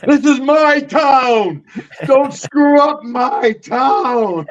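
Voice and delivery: high, drawn-out roar, word by word; acted furious shout. Wrestler in jail warning his nephew, slow high shout. Acted yelling after the headbutt.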